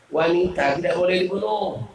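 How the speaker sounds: ordinary talk, nothing marked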